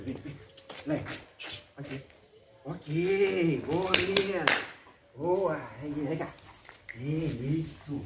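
soft praise for a dog; talking to a dog. Real, not acted. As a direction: coax the puppy gently.

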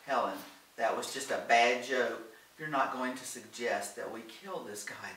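usual speaking voice

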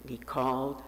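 ordinary delivery

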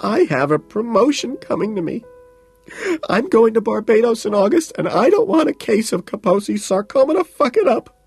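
very high, loud hysterical outburst; hysterical, tearful. A narrator's reading. Woman protesting through tears, hysterical. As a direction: wail it out, racked with tears. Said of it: "She's hysterical, racked with tears, choking".

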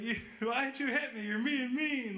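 high, put-on voice of a hurt friend; childish complaint. Slapped friend accusing his buddy, childish complaint. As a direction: whine it as a mocking imitation.

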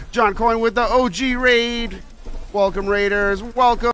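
high, hyped-up welcome; excited announcement. Real, not acted. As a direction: announce the arrivals like big news. Streamer announces raid.

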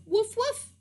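high imitated woofs; dog bark. Storyteller showing the dog its noise, high barks. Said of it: "You go".

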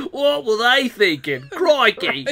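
hammed-up bad Australian accent; mock Australian catchphrase. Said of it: "bad Australian accents".

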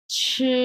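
ছি held high and level; first tone. Shown as a demonstration. Teacher models the word for eating, high level first tone on ছি. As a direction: stretch ছি on a high, even pitch. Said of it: "এক number tone".

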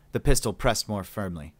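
ordinary delivery, nothing marked

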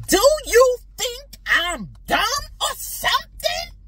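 high, loud acted outburst; yelling. Partner accusing the wife of calling them dumb, yelling. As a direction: yell it as an offended partner, pitch way up. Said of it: "forgive me for yelling".

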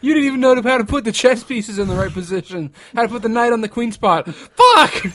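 high, loud outraged rant; yelling. Acted. Acting out a character furious about chess pieces, yelling. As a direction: yell it, exploding on the final curse. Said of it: "What if he just yelled that?".

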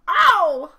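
very loud, high falling yowl; howl of pain. Acted. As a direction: howl as if tripping over a stump. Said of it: "howled Mister Winky".

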